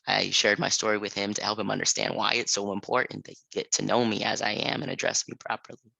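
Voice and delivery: ordinary talk, nothing marked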